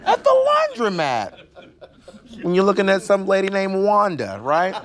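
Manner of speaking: high laughing squeal. Real, not acted. Laughing at own story.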